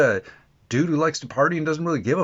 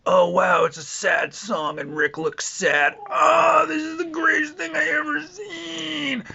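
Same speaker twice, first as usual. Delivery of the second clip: mock gushing fan; slower than usual. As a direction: parody a fan who is overcome with emotion, breaking into a shouted wail. Mocking fans gushing over sad scenes.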